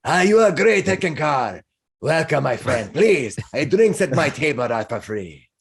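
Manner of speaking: effusive, hearty welcome in character; boisterous Minsk. Acted. Balding man welcomes the famous hero, effusive.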